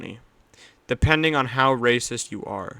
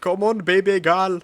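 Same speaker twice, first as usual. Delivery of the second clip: put-on Indian accent; 6 semitones higher, 6 dB louder than usual. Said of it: "that was Indian".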